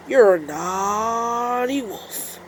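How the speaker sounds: very slow turtle scolding; slow Mister Turtle. Acted. Mister Turtle scolds the wolf, very slow disapproving voice. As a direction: drawl it out disapprovingly. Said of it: "added Mister Turtle".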